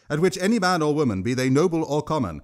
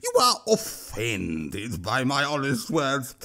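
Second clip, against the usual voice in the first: troll voice =36. A narrator's reading. Troll voice continues.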